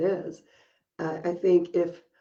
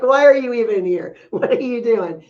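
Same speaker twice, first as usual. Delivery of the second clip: incredulous teacher; 4 semitones higher, 7 dB louder than usual. Retold or imitated. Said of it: "she's like".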